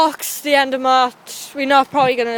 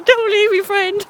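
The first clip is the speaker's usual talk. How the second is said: higher, hammed-up fake whimper; mock crying; 6 semitones higher, slower than usual. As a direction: play left-behind and wail. Left behind on walk.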